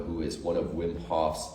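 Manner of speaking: usual speaking voice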